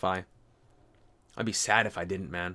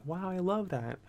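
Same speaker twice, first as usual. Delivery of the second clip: awed viewer; 6 semitones higher, 4 dB quieter than usual. Retold or imitated. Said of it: "inspirational and like".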